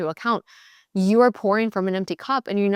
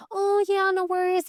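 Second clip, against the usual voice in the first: sugary people-pleaser; 10 semitones higher than usual. Retold or imitated. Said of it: "we're like".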